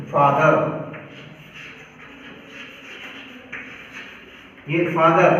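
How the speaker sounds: फादर low, with an open aa and no r; correct pronunciation. Shown as a demonstration. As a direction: give the standard फादर, r dropped. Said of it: "right pronunciation है". (फादर सही उच्चारण, लंबा आ और र नहीं)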